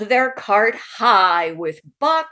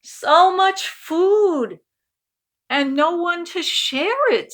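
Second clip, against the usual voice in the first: much higher, slow sighing lament; wistful lament; 10 semitones higher, 5 dB louder, slower than usual. Acted. Aunt Helene lamenting food with no guests, high and slow. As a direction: sigh it high and sadly.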